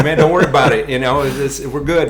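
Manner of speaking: high, loud retold reassurance; hearty reassurance. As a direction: reenact brushing away someone's nerves. Calms nervous celebrity before fire walk.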